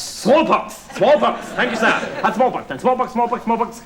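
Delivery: high, energetic bid-calling; excited auctioneer repeating bids; auctioneer patter. Acted. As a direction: seize the new bid and chant it. Auctioneer taking a new bid.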